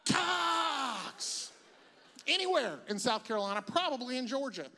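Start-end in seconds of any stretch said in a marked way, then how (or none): 0.0-1.5 long, high chant shouted full out; stadium yell. Acted. Eighty thousand grown men at a game, full-out yelling. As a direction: yell it like a roaring football crowd. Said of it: "Eighty thousand grown men yelling".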